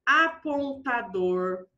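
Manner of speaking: stretched out syllable by syllable; slow dictation. Shown as a demonstration. Teacher giving pupils a long example word, slowly dictated. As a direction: dictate slowly so they can write.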